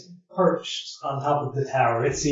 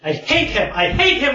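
rising higher with each repeat; mounting hatred; 10 semitones higher than usual. Acted. Giant bird rants about its enemy, mounting hatred. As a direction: build toward a frantic, high-pitched outburst. Bird's hate builds through repeats.

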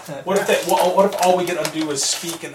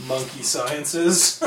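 deadpan joke; 6 dB quieter, much slower than usual. Acted. Joke answer in character.

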